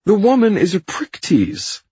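loud, high whining protest; whiny Hyde. A narrator's reading. Fired man protesting into the phone, high and whiny. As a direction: protest indignantly in a high whine. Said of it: "Hyde is protesting into the phone".

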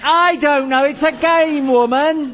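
high-pitched, worked-up defence; exasperated retort. Acted. Game explainer defending his rules, exasperated. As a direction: throw back an absurd answer, fed up.

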